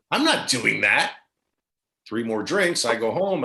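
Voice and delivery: ordinary talk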